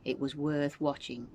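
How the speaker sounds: usual speaking voice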